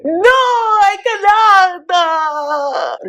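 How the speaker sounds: high, slow, dramatic refusal; mock Natalie refusal. Host mimics Natalie pleading no in a high voice. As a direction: draw it out like a wounded diva.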